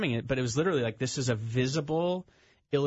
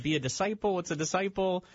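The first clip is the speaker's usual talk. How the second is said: mock baffled student; 7 semitones higher than usual. Confused students quoted in story.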